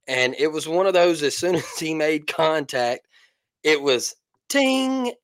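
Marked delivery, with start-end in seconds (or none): 3.6-5.1 bat ting. Retold or imitated. Imitating bat contact.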